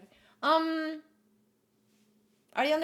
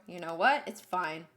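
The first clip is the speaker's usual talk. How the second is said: ordinary delivery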